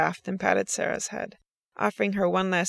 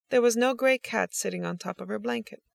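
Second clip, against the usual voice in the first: ordinary delivery, nothing marked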